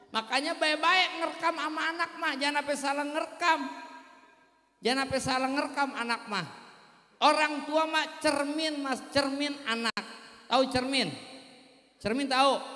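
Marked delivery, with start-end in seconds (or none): none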